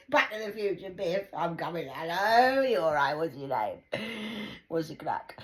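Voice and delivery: loud jolly bar talk; boisterous pub chat. Retold or imitated. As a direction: call out like a merry drinker.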